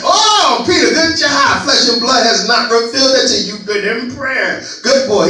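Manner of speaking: high, loud mock praise; Jesus cheering Peter on, playfully loud; Jesus praising Peter. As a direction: cheer him on like a proud teacher. Jesus praising Peter in retold story.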